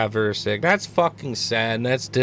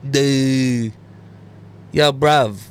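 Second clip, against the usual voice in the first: loud, put-on British street talk; hammed-up English "bruv" accent; mock English accent; 5 dB louder, much slower than usual. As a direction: caricature an English lad's accent. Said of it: "Yeah, they'll be like".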